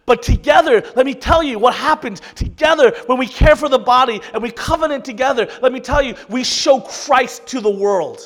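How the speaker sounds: thundering pulpit climax, let me tell you; preaching at full force. Acted. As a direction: build the sermon to a shouted peak. Sermon climax, building to shout.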